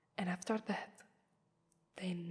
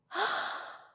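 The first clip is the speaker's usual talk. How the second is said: mock gasp; 11 semitones higher than usual. Mock realisation.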